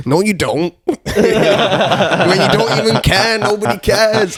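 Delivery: high, mock-wounded outburst; retold upset protest. Veteran protesting to the man thanking him, high mock upset.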